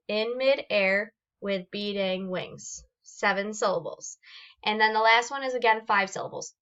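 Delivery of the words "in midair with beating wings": "In mid-air with beat-ing wings" slowly, a beat per syllable. Shown as a demonstration. Teacher counting seven syllables of "In midair with beating wings", slow and separated. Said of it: "how many times am I clapping".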